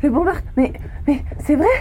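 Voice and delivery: high, rising panic; alarmed and disbelieving; shocked. Acted. Shocked to hear Earth's fate.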